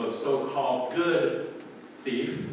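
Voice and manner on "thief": ordinary talk, nothing marked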